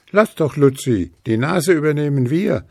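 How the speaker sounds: the hares. A narrator's reading. Hares offer Luzi help, high and eager. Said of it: "sagten die Hasen".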